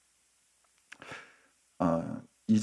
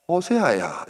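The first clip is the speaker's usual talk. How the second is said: higher, falling call of the name; God calling; 7 semitones higher than usual. Acted. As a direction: raise the pitch to summon him, then let it drop. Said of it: "또 부르는 겁니다". (높였다가 내리며 부르는 하나님 음성)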